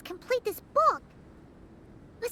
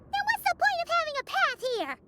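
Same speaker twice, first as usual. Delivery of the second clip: higher, indignant complaint; higher indignant question; 6 semitones higher than usual. Acted. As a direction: gripe at the lazy writer. Annoyed at dead end.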